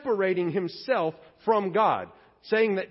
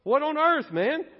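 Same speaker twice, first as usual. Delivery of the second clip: whoa, higher, stunned; shocked reaction; 5 semitones higher than usual. Retold or imitated. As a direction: react like you've been caught out. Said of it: "You're going".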